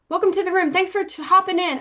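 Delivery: high, perky mock greeting; mock welcome. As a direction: play the chirpy host welcoming everyone. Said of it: "I don't say every single person's little name".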